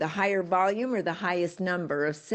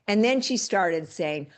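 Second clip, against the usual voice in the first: ordinary talk, nothing marked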